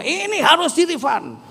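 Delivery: high, insistent wife demanding a refund; angry wife. Retold or imitated. Wife at home pressing her husband for a refund, angry and high. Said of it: "Marah dia".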